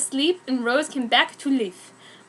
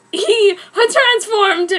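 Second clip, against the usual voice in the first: hammed-up transformation; 9 semitones higher, 7 dB louder, much slower than usual. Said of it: "dramatic transformation".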